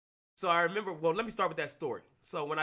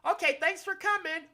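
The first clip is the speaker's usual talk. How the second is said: high, sing-out brush-off; mock-sweet casting director, pitched way up; fake-polite dismissal; 11 semitones higher, 3 dB louder than usual. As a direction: send them away in a high, falsely cheery voice. Voices casting person's polite dismissal.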